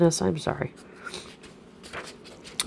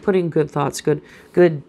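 usual speaking voice

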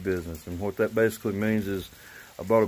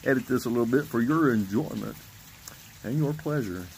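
late-night commercial voice; 3 semitones higher than usual. Real, not acted. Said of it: "sounding like a bad commercial you see on late night T V".

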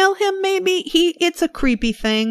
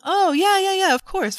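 higher, mock flustered backpedal; flustered realization; 12 semitones higher than usual. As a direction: cover an embarrassing slip, caught out. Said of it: "turns to him and is like".

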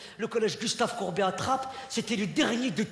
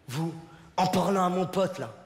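ordinary delivery

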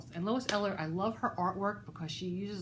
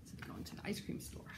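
whispering; 11 dB quieter, faster than usual. Real, not acted. Whispered aside to listeners.

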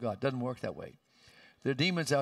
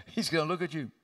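usual speaking voice

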